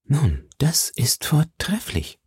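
slow voiceless whisper of approval; approving whisper. A narrator's reading. Athos praising Aramis's letter in a slow whisper. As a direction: murmur the praise without voice, slowly.

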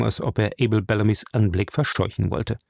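ordinary delivery, nothing marked

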